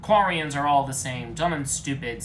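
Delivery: loud contemptuous jeer; loud sneer. A narrator's reading. Legion insults the Quarians, loud jeering. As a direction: spit the insult out loudly. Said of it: "said Legion with racism".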